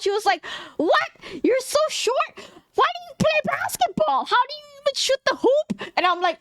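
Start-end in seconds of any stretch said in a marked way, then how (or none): 0.8-5.9 high squawking imitation of a loud girl; hammed-up, high-pitched incredulous questions, barely louder; mock loud classmate. Imitating a classmate doubting she plays basketball, mock loud and high. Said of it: "she was so fucking loud".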